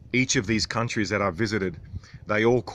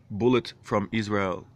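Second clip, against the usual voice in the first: normal talking voice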